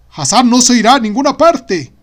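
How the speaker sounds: loud, high booming shout; bellowing father. Acted. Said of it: "había vociferado Baba".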